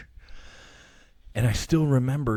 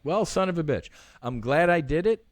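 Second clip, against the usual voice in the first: lightly acted, higher-pitched comic grievance; mock rueful exclamation; 7 semitones higher than usual. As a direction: toss it off high, half put out. Acting out his rueful reply.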